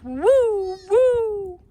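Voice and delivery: high, loud woofs; dog barking. A narrator's reading. The daunted dog barking high and loud. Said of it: "who barked".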